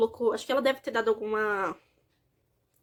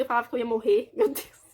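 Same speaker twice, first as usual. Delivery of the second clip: ordinary talk, nothing marked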